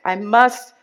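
loud, desperate plea for help; desperate begging. Retold or imitated. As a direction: call out to God, begging. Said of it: "I begged".

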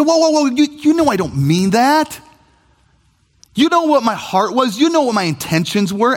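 back-pedal, insisting you meant well; defensive protest. Retold or imitated. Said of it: "We say".

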